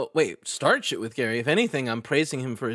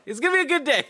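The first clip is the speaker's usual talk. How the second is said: much higher, sing-song cheery voice; sing-song self-pep talk; 14 semitones higher, faster than usual. Retold or imitated. Producer retelling his pep talk again, higher and giddy. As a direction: lift it up into a giddy chirp. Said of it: "So I was like".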